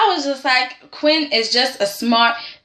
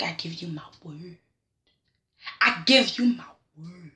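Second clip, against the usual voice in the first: hushed promise; 7 semitones lower, 8 dB quieter than usual. Retold or imitated. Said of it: "Quinn was just like".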